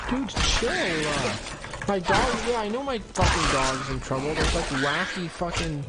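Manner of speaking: high, loud frantic shouts; panicked yelling. Real, not acted. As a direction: yell in alarm to calm things. Dog in trouble, panicking.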